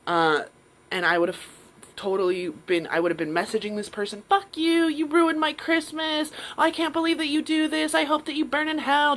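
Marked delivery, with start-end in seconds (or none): 4.3-9.2 higher and louder, slowed on the insult; imitated angry rant. Imagined angry message to the scammer, higher and louder. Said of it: "Just, like, angry".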